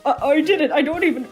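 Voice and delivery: high, loud, panicked stammer; flustered denial. Acted.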